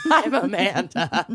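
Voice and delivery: laughing out her name; giggling through the intro. Real, not acted.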